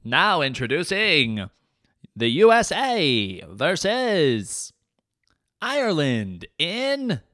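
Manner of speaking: mock Olympics announcer introducing a lazy event. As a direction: stretch every word like a stadium announcer.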